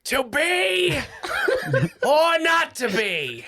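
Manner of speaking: high, slow, grand stage voice; Shakespearean actor. Pretend. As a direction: declaim it like Hamlet on stage. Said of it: "[name] is a Shakespearean, trained actor".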